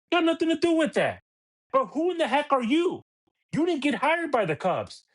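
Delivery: heated rant at a radio host; exasperated. Real, not acted. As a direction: vent at him, voice climbing.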